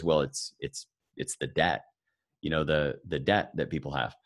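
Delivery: lightly done dry Ben Stein answer, flat and quiet. Retold or imitated. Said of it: "a very dry actor".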